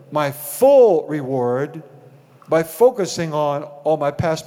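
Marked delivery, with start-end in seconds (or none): 0.1-1.8 full reward stretched slow and loud; a slow, swelling full reward; stress on full. Shown as a demonstration. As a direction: drag out full reward, louder. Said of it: "he emphasizes the word full reward".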